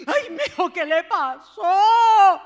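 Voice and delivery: high, slow, loud crying concern; mother running to her hurt son; worried parent. Acted. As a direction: rush in like an alarmed mother. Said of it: "se baja esas escaleras".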